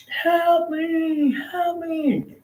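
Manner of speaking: high, desperate scream for help. Retold or imitated. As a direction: cry out trapped in a burning room. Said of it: "she was screaming".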